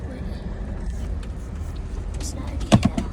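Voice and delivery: ordinary delivery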